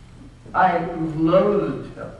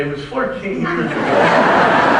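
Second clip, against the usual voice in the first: wife's jibe; 3 semitones lower, 6 dB louder, slower than usual. Retold or imitated. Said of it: "listen to my wife say".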